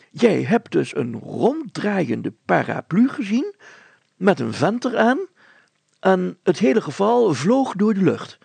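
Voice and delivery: a bewildered official summing it up; mayor repeating it in disbelief; incredulous mayor. Acted.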